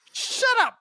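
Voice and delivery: squeaky shut up; high mock snap. Friend voicing the sheriff yelping shut up. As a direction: yelp it in a silly high voice.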